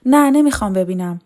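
loud, pained refusal; refusing. Acted. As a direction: push the suit away with a cry. Laqa refuses to look. (امتناع بلند و دردمند)

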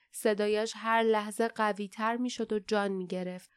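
plain reading voice

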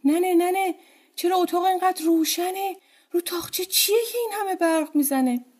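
put-on high, excited child's call; a small girl waking and calling her mother; high-pitched girl calling to mother, startled awake; little girl calling out. A narrator's reading. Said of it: "از خواب پرید و داد زد".

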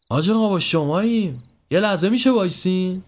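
high-pitched call for attention. Acted. Traveller calling after an old man who ignores him, calling out.

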